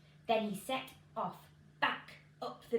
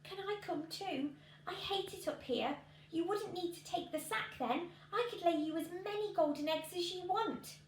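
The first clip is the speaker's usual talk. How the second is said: goose voice; 8 semitones higher than usual. A narrator's reading.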